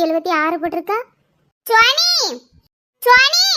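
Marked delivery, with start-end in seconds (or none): 1.7-3.4 high-pitched call of a name. Acted. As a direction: call out for someone not in sight. Calling out for the girl.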